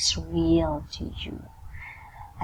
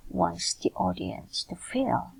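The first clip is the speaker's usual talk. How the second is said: ordinary delivery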